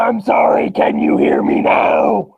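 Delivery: loud, slow, swooping down at the end; hollow, hammed-up shout; mock shout into the mic. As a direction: yell as if the mic can't catch you. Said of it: "that sounded actually really hollow".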